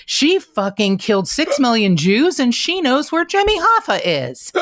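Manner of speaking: high, loud hammed-up accusation; mock outrage. Examiners accusing her after the polygraph, in mock outrage. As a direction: exaggerate it as an absurd accusation, voice climbing.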